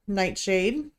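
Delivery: a stray accent creeps into the word Nightshade; odd slipped accent. Real, not acted. Tutor reading a pencil label, slips into an odd accent. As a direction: say Nightshade with an accidental put-on accent. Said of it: "where'd that accent come from?".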